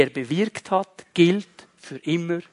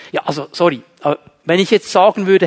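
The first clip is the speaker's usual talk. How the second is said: ordinary delivery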